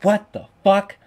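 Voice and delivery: sudden loud disbelief; shocked outburst. Retold or imitated. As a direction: spit it out stunned. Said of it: "I was like".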